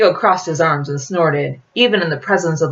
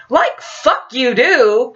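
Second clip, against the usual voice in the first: slow, biting snap; snapping; 8 semitones higher, much slower than usual. A narrator's reading. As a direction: spit it out. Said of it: "Quentin snapped".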